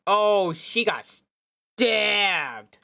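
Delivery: loud, slow horrified cry; loud slow gasp of horror at the knife wound; horrified realisation. Acted. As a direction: let the horror spill out slowly.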